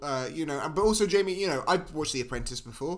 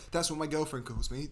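normal talking voice

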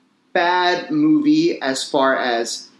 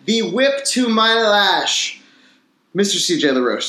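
upbeat podcast host opening the episode; show host intro; 5 semitones higher than usual. Acted. Host welcoming viewers to the show, announcer style. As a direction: welcome the listeners like a presenter.

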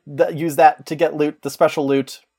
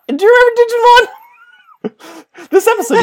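laughing; 6 dB louder than usual. Real, not acted. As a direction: crack up while asking. Laughing at own digression.

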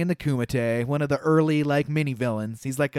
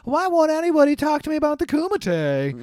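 high-pitched mock whine; whining reporter; 10 semitones higher than usual. Movie podcast host mocking the reporter's complaint, high mock whine. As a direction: imitate a frustrated reporter, pitched way up.

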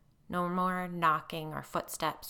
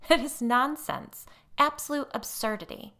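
scornful dismissal; 8 semitones higher, 7 dB louder than usual. A narrator's reading. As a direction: brush it off with a shrill put-on scorn. Molly after forced laugh.

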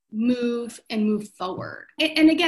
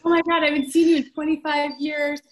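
excited reunion; 5 semitones higher, 6 dB louder than usual. Retold or imitated.

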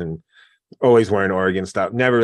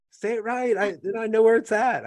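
retold ball-busting, pitched way up; teasing retort; 12 semitones higher than usual. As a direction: needle the boss in a raised voice. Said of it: "I was like".

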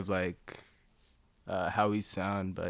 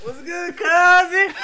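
much higher and louder street greeting; cousin voice; 10 dB louder, slower than usual. Retold or imitated. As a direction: call out to a stranger on the street. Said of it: "this cousin thing he does".

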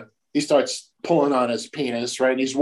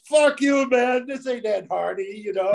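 mock prank caller; 11 semitones higher than usual. Prank caller voiced on phone.

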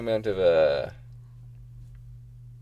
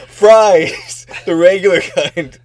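lightly acted, raised and loud insistence; higher, louder mock order; 6 semitones higher, 9 dB louder, much slower than usual. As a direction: stretch it out loudly, playing the misunderstood customer. Acting out the drive-through order mix-up.

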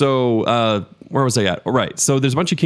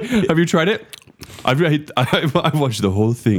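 self-parody impression; 5 semitones higher than usual. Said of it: "See, it sounds exactly like you".

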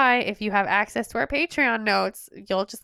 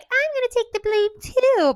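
much higher put-on voice; Ginny; 12 semitones higher, 3 dB louder than usual. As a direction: pipe up in a high imitation. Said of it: "Ginny's like".

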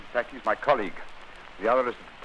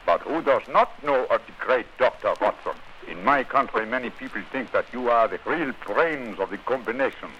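put-on posing as a German count, German accent; a stiff German accent, in disguise. Holmes greets his visitors as the Graf, German accent. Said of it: "if I can make the accent reasonably convincing".

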